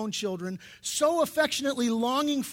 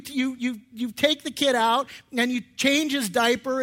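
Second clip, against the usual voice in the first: usual speaking voice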